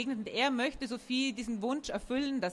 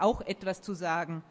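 plain reading voice

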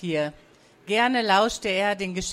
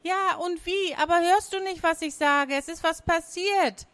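put-on high-pitched, agitated girl; urgent, high young voice; insistent little sister; 8 semitones higher than usual. A narrator's reading. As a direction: plead to be believed in a high child's voice.

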